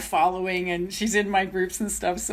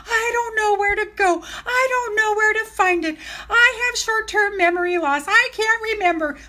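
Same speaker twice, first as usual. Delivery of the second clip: very high, whiny self-parody; mock helpless whining; 11 semitones higher than usual. Mimicking her own excuses about technology, mock helpless wail. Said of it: "'Cause I'm like".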